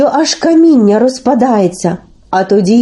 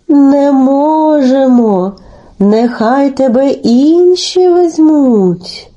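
very slow, drawn-out goose voice; refusing geese; much slower than usual. Acted. Geese turn Telesyk down, slow drawn-out refusal. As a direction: stretch every vowel like a passing flock. Third flock refuses him.

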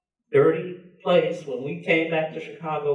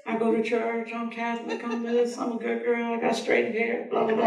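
high, softer, hammed-up denial; mock protest; 9 semitones higher, 4 dB quieter than usual. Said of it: "I was like".

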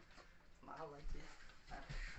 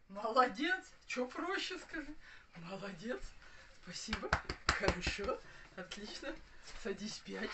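talking to a dog. Real, not acted. Praising the dog, lively high voice.

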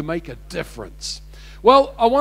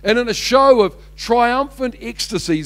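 normal talking voice

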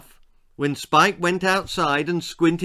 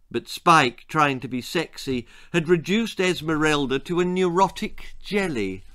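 ordinary delivery

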